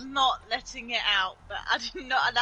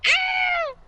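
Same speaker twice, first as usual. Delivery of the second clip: mock yelp; 9 dB louder than usual. Acting her jump scare.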